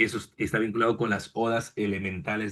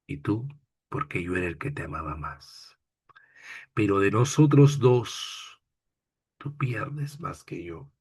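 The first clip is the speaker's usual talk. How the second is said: poem recitation. Acted. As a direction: read it like a heartfelt farewell poem. Teacher recites a love poem.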